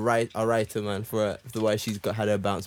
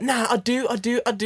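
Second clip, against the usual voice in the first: higher, mock-earnest protest as the girlfriend; 10 semitones higher than usual. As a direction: play her insisting, pitched up. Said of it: "And then she's like".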